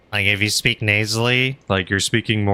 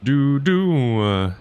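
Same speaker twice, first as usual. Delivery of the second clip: ordinary talk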